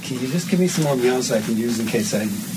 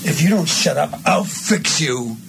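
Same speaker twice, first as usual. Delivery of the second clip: threat; 6 semitones higher, 4 dB louder than usual. Shown as a demonstration. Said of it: "this is that big line".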